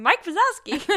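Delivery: high, loud imitation of a small child; mock toddler shout, high and loud; child's voice. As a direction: squeal the name like a little kid. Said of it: "the little child keeps going".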